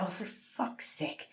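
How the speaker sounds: very quiet, slow, breathy dismay; exasperated mutter. Acted. Security staff facing rowdy holidaymakers, exasperated. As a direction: mutter it wearily under your breath.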